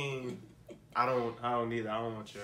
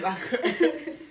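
laughing; 10 semitones higher than usual. Real, not acted. Laughing at the video.

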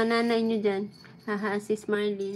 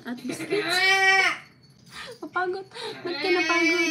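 high laughing fake cry; reacting to a viewer comment, high laughing mock cry; laughing mock whine; 8 semitones higher, 6 dB louder than usual. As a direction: whine it through laughter, pitched up.

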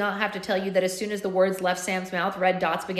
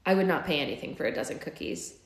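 plain reading voice

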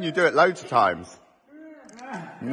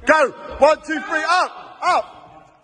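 excited count; 10 semitones higher than usual. Real, not acted. Counting a lift, cheering.

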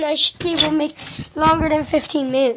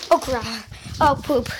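ordinary delivery

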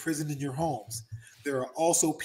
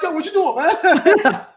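laughing mock challenge; 14 semitones higher, 10 dB louder, slower than usual. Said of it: "they're like".

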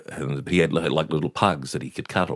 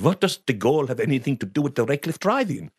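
put-on higher, puzzled Dutch-accented voice; perplexed Dutch accent; 7 semitones higher than usual. Dutch customer lost in dad's chat, perplexed Dutch accent. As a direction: imitate a baffled Dutchman's accent. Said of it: "Ankers was just totally perplexed".

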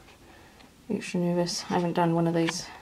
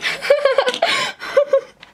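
high, loud burst of laughter; real squealing giggle of disbelief; elated laugh; 5 dB louder than usual. Real, not acted. Confirms second positive test.

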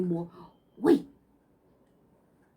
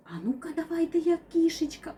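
ordinary talk, nothing marked

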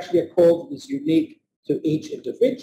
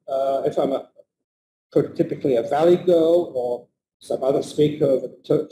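ordinary talk

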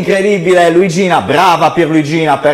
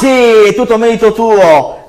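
playful agreeing; 9 semitones higher, 5 dB louder than usual. Acted. Magician humours the puppet.

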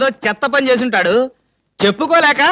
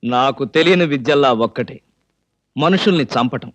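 very low, measured menace; veiled threat; 12 semitones lower than usual. Acted. Accused man answering his taunting accuser, veiled threat. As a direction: drop the pitch right down and state it deliberately.

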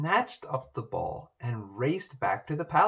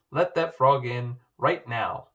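louder, angry fatherly command; furious order; 6 dB louder than usual. A narrator's reading. Father orders Poppy to let the frog in, furiously. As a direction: order it crossly, with raised volume. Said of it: "cried her father furiously".

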